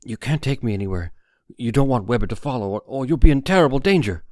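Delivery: panicked warning, building to a near shout; frightened, rising panic. A narrator's reading. Harry's frightened plea.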